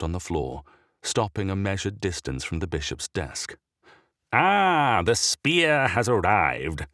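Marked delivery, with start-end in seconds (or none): 4.3-6.9 put-on booming, rasping old churchman; loud raspy voice. A narrator's reading. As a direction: rasp it out loud and slow. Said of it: "said a loud, raspy voice".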